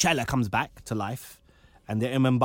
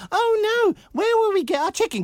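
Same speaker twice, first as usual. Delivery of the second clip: mock whining kids; 5 dB louder than usual. Mocking kids crying over KFC.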